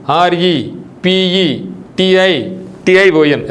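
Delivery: letter by letter, very slow; slow spelling out. Shown as a demonstration. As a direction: spell ఆర్ఈపిఈటిఐ out one letter at a time, drawn out. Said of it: "first four letters బాగా గుర్తుపెట్టుకోండి".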